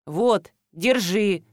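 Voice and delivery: plain reading voice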